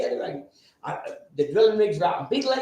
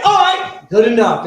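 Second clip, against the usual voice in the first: loud; 4 semitones higher, 10 dB louder, slower than usual. Retold or imitated. Angry after being kicked off.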